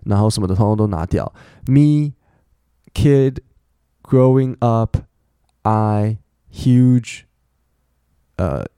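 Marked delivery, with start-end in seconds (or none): 1.7-7.2 put-on "Me, kid, growing up" as separate stressed words; each key word held full and set apart; content words only. Shown as a demonstration. Teacher strips a sentence to key words, isolated stressed words. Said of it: "我现在把所有的那些介系词、".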